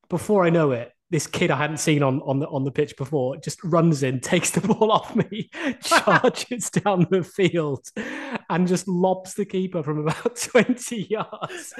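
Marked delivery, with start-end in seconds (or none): 4.2-11.8 slow, laughing retelling; can't stop laughing while telling it; laughing through it. Real, not acted. Telling the podcast host about the goal, laughing.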